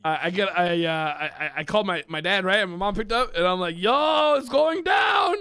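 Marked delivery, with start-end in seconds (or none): 3.8-5.3 drawn-out, raised-pitch shout; acted-out alarm. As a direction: stretch it out like breaking urgent news. Retells alarming call to mom.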